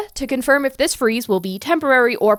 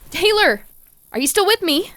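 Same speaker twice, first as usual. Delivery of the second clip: calling out; 7 semitones higher than usual. Acted. Dan calls to silent Taylor.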